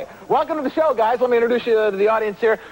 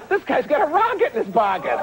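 giggling innuendo; 7 semitones higher than usual. Retold or imitated. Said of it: "Another lady said".

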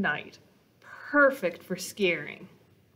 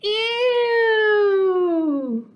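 long, high, loud ew; disgusted shout; 11 semitones higher, 8 dB louder than usual. Acted. Monsters yelling in disgust at kissing. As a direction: yell it like kissing is the worst.

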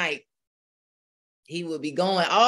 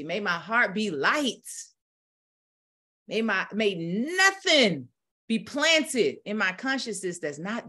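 preaching; 7 semitones higher than usual. Acted. Preacher rousing the congregation in full fire. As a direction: preach it like Sunday morning in a Baptist church. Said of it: "I'm taking off the preacher hat".

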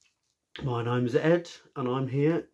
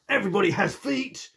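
Hippo's voice; 9 semitones higher, 4 dB louder than usual. Acted. Hippo argues for stomping.

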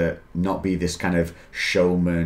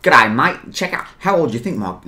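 higher-pitched bad Aussie accent; botched Australian accent; 4 semitones higher than usual. Shown as a demonstration. Friend mocks accents but lands in bad Australian. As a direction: aim for British, slip into Aussie. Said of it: "No, I'm bloody Australian".

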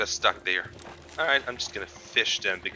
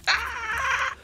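sudden high shriek at the ocean; squeaky bat screech. Pretend. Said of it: "What kind of impression of a bat was that?".

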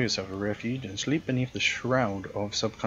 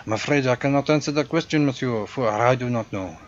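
louder, hammed-up French-European accent for a snooty concierge; hotel concierge greeting a guest, in a louder generic European accent; 3 semitones higher, 6 dB louder than usual. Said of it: "my generic European accent".

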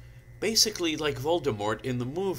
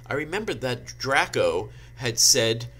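plain reading voice

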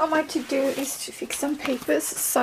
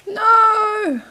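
high, loud cry of dismay; 8 semitones higher, 7 dB louder than usual. Real, not acted. Learner reacting to a failed French lesson, crying out. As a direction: yelp it as the answer fails.

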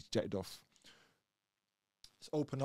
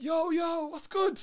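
high, delighted hail; greeting his boy Pimp from Reading; excited greeting; 6 dB louder, slower than usual. Retold or imitated. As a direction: greet an old friend with joy.

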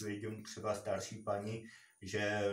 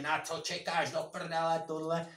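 mouthing off; 5 semitones higher than usual. Retold or imitated. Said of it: "A začne mít keci".

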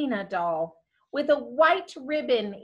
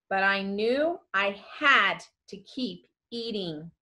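ordinary delivery, nothing marked

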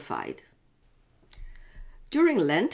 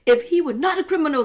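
indignant leaders; 10 semitones higher, 6 dB louder, faster than usual. Acted. Leaders answer Pilate's question.

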